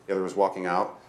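ordinary delivery, nothing marked

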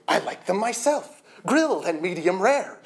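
high-pitched, forceful outburst; explosive retort. A narrator's reading. Narrator snapping back about liking cows, explosive. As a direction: fire it back, worked up. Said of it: "I exploded".

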